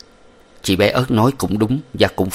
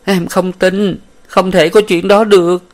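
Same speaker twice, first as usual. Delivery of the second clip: high, louder wailing denial; crying out; 11 semitones higher, 6 dB louder than usual. A narrator's reading. Huyen refusing to believe Minh sold her, wailing. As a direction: cry out that it can't be true. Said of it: "Nàng òa lên khóc lớn hơn".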